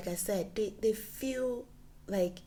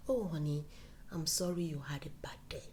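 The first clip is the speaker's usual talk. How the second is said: soothing apology; 5 semitones lower, 5 dB quieter than usual. Retold or imitated. Voicing wife's soothing apology.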